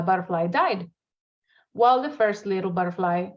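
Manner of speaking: usual speaking voice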